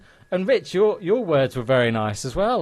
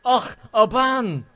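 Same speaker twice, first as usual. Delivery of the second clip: loud, high, drawn-out mock Scottish accent; imitated Scottish farmer; 10 semitones higher, 6 dB louder, slower than usual. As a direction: exclaim like a Scottish farmer finally catching on. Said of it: "it was like some farmer guy".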